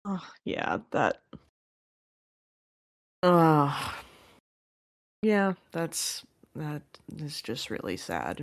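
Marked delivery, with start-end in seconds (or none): 3.2-4.0 sad, pained ugh; pained groan. Real, not acted. Host reacting to a heartbreaking scene with a groan.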